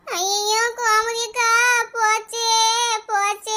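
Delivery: long drawn-out, keening cry; slow, hammed-up mourning; wailing lament. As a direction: stretch each word into a stagey wail. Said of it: "போச்சேன்னு வந்த நேரத்துல இருந்து கத்திக்கிட்டே இருக்கா".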